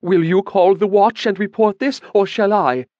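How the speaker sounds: high, loud challenge; a woman challenging the mage to report the theft; pressing challenge. A narrator's reading. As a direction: dare him to call the watch. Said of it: "she pressed".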